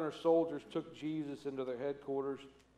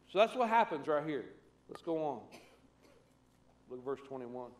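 ordinary delivery

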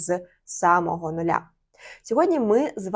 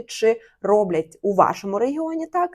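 ordinary talk, nothing marked

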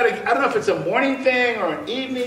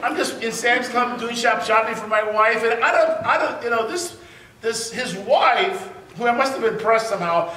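ordinary delivery

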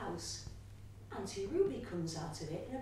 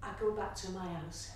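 ordinary delivery

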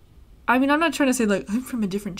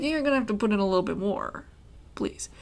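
ordinary talk, nothing marked

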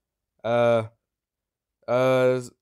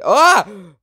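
short high shriek; jump-scared yelp; loud sudden ah; startled scream; 11 semitones higher, 17 dB louder than usual. Real, not acted. Sudden in-game shock.

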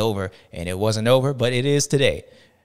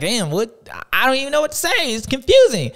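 higher, quicker, puzzled; mock confusion; 9 semitones higher than usual. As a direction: play it lost for words. Acting out confused reaction.

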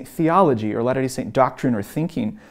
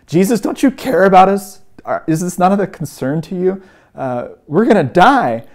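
raised, louder retold panic; imitated frightened appeal, higher pitch; freaking out; 6 semitones higher, 6 dB louder than usual. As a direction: act out alarmed disciples pleading. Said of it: "the disciples are freaking out".

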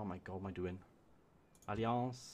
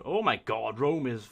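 ordinary talk

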